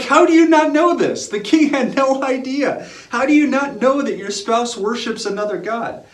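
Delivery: loud, high exclamation of disbelief; incredulous. Acted. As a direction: exclaim it, amazed at such ignorance. Mock disbelief at king's ignorance.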